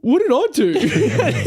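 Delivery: high, drawn-out innocent protest; slow, high mock-wounded question, near laughter; imitating hurt innocence. Nice stranger asks the man who snapped at him, hurt and innocent. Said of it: "He was, like, so serious".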